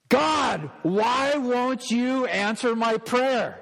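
high, drawn-out cry up to God; anguished prayer cry. Acted. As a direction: cry out a desperate, frustrated prayer. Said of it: "See if you can recognize it".

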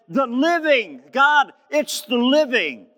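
very high, loud preacher's shout; crying out. Retold or imitated. Preacher voicing a man's prayer, shouting out. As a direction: shout it up to God with full fervour. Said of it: "he's crying out to God".

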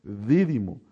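"Dídimo" stressed on the first syllable, DI-di-mo; corrected stress. Shown as a demonstration. Preacher confirming the corrected name, "Dídimo" with first-syllable stress. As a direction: land on the first syllable of "Dídimo", then fall away. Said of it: "así era".

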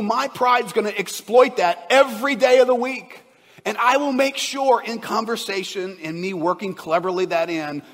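preaching at full force. Acted. Pastor warning the congregation about pride, full force. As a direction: drive the point home to the crowd.

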